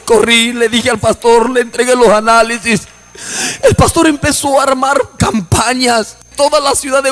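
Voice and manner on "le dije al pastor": normal talking voice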